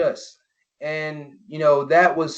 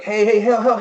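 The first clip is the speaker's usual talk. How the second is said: retold cry for help; 6 semitones higher, 3 dB louder than usual. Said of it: "He's like".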